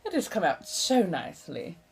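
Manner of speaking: put-on a lightly held eighteenth-century colonial American accent; a mild colonial American accent, chatty and theatrical. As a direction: keep the old colonial American lilt going as you chat. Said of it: "the accent is, uh, roughly seventeen hundreds colonial American".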